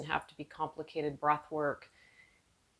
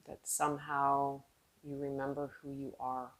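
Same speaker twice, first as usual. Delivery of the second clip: calm, unhurried meditation voice with long pauses; guided meditation; 3 dB quieter than usual. Real, not acted. Yoga teacher guiding seated students inward, calm guided meditation. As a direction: ease them inward, letting phrases trail off softly.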